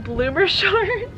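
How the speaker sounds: cooing. Real, not acted. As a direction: coo and giggle over it. Cooing, laughing.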